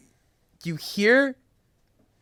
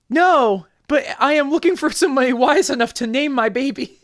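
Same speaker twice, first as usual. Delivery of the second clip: high, louder woman character; woman's voice; 9 semitones higher, 4 dB louder than usual. Acted. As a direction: play an earnest mother, pitched well up. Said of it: "The woman yell-, the woman says".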